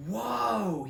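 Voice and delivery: high, drawn-out whoa of wonder; a boy awed by an old torch; amazed whoa. A narrator's reading. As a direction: marvel at the torch. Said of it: "he said, gazing at it".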